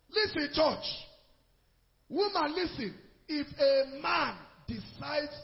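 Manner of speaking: loud, high call to the church; fervent preaching; preaching at full force. Real, not acted. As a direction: call the women to listen. Preacher calls out to congregation.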